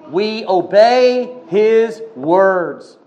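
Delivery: each word slowed and weighted; slow emphatic. Real, not acted. Preacher to congregation, slow and emphatic. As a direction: lay it down slowly, word by word. Preacher stressing obedience slowly.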